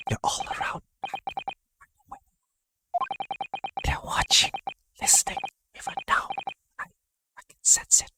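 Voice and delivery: paranoid breathy whisper. Acted. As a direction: hiss it as if someone is listening. Paranoid prisoner hides in dark.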